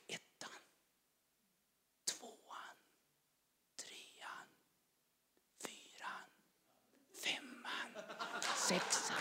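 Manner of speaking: breathy whisper turning to a laugh; whispered count. Real, not acted. Man counting his years in church, whispering.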